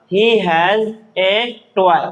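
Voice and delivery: very slow, word by word; drawn-out words, evenly spaced; slow reading drill. Shown as a demonstration. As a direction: model the sentence for beginners, slowly. Teacher models sentence to learners.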